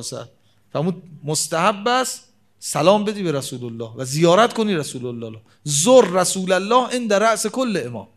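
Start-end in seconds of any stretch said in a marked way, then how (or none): 5.6-8.1 raised, louder Arabic quotation; pulpit recitation. Acted. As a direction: declaim the hadith with weight on زر. Quoting the hadith from the pulpit.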